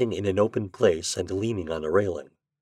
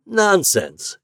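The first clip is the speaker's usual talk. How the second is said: high, loud exclamation; exclaiming; 11 semitones higher, 5 dB louder than usual. A narrator's reading. Ford dismissing the doctor's request, exclaiming. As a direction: burst out in dismissal. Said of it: "exclaimed Ford".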